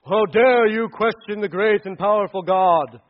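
mock angry God. Pastor mocks an angry God, slow booming outrage. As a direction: thunder it like an offended deity.

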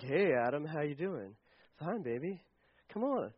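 low, soft pillow talk between lovers; lightly voiced, hushed couple teasing each other; Adam and Eve flirting.

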